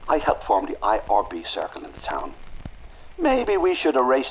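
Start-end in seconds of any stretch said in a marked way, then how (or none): none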